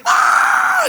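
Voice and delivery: high loud mock scream; aunt's scream imitated in a story; imitated wail. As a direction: belt a shrill mock wail. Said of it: "gritando y llorando".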